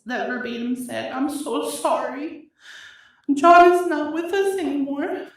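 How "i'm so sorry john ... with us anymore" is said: sobbing out the words; crying. Real, not acted. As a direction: voice cracks high and loud. Quoting sister's call while crying.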